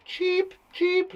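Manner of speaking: high-pitched chirping cheep; squeaky, short bird chirps; little birdie. Shown as a demonstration. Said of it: "like a little birdie".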